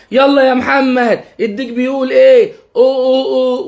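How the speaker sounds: singsong prompt and rooster call; playful coaxing. Shown as a demonstration. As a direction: cheer him on like a game, then crow. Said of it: "بأسلوب فيه لعب".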